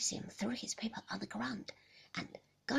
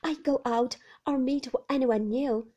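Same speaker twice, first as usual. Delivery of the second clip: put-on high, pleading young woman's voice, louder; anxious young wife; 4 semitones higher, 10 dB louder than usual. Young wife pleading with Maxim about visitors, higher and louder. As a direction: plead to be understood, voice lifted high.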